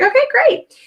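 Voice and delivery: high-pitched, chirpy customer reply; retold cheerful agreement. Customer relieved of pressure to buy, cheerful. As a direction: agree brightly, pitch jumping right up.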